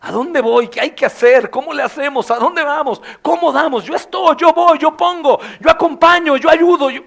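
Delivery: high, loud, tumbling eager offers; eager excited believer. Pretend. Forgiven brother eagerly volunteering for the Lord's work, loud and high. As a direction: shout out every offer to help. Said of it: "se desviven por la obra".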